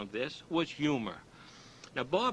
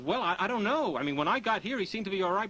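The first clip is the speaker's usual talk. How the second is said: higher, louder bewildered bystander; clueless onlooker; 6 semitones higher, 4 dB louder than usual. Acted. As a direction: play the confused witness, a bit raised. Clueless onlooker explains to policeman.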